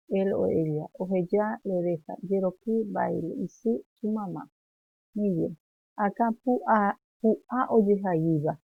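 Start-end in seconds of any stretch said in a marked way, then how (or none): none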